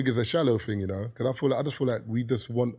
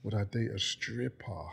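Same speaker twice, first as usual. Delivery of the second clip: slow, softer British drawn-out question; UK accent; 8 dB quieter, slower than usual. Real, not acted. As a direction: draw out 'stripper' in a UK accent. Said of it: "Why you say it like that".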